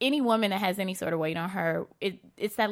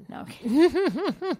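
laughing; 9 semitones higher, slower than usual. Real, not acted. Teasing aside, laughing it off.

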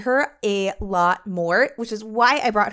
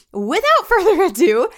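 high laughing lift; laughing, bright and high; excited; 10 semitones higher, 7 dB louder than usual. Real, not acted. Excited podcast intro laugh.

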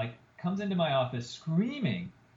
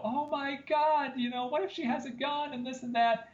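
much higher-pitched mock-panicked voice; imitated panic; 10 semitones higher than usual. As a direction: mimic alarmed staff, pitch shooting up. Retold staff panicking behind locked door.

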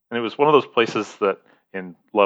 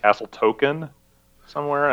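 usual speaking voice

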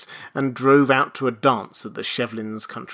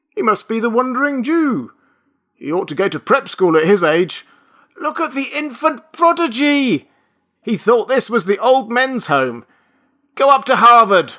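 higher, louder jeering crowd voices; taunting students; 11 semitones higher, 6 dB louder than usual. A narrator's reading. Undergraduates taunt Benjamin, jeering. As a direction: jeer like a mocking mob.

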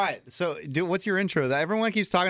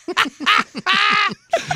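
loud, high burst of laughter; loud shrieking laugh; 6 dB louder than usual. Real, not acted. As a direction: howl with laughter. Reacting to her raptor sound.

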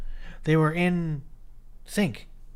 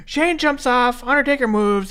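usual speaking voice

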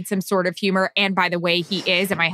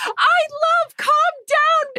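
very high, drawn-out fan squeal; excited screaming; much slower than usual. Retold or imitated. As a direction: imitate a fan shrieking with excitement. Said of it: "she was screaming".

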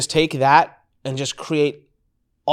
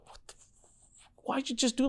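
breathless half-whispered disbelief; mock stunned whisper; stunned hush; 11 semitones higher, 13 dB quieter than usual. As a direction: hush the words in shock. Stunned retold reaction.

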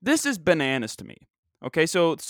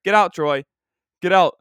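1 shooing the dog; 2 semitones higher, 8 dB louder than usual. Real, not acted. Shooing his dog from the room.

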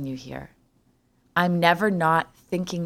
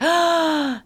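sharp gasp of horror; shocked gasp; 10 semitones higher than usual. Retold or imitated. Gasping in shock at the open onesie. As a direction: gasp as if you just saw it. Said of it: "and I'm like".

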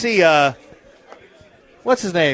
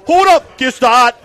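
mock drunk yell; 5 semitones higher, 6 dB louder than usual. Mock barfly yelling at someone.

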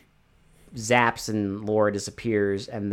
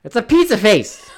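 higher, louder insistent joke; emphatic; 9 semitones higher, 7 dB louder than usual. Real, not acted. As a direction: insist on it, half laughing.

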